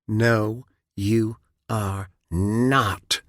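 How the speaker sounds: each word of "you are not" hit slow and separate; cold, sharp emphasis. Acted. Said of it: "emphasizing each word sharply, coldly".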